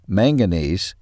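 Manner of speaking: usual speaking voice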